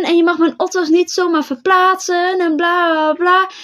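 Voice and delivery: high-pitched imitation of an irate neighbour; mocking, high-voiced complaining; mock angry rant. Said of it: "Wordt helemaal fucking boos".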